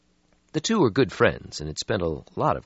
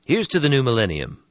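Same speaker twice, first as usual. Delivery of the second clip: lively toast, a bit higher; young vampire voice; 8 semitones higher, 5 dB louder than usual. Acted. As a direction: raise the glass cheerfully. Lucky toasting in story.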